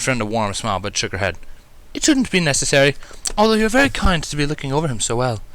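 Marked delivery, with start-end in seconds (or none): 1.9-5.4 slightly higher, refined kindly lady; Rarity. A narrator's reading. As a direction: decline politely with a warm smile.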